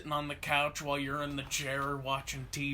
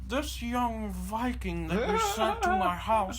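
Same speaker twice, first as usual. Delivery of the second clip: higher-pitched put-on old man; complaining old man; 6 semitones higher than usual. Old customer phones to complain about the mover, put-on old man. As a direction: play an indignant old caller. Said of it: "the old guy was probably on the phone like".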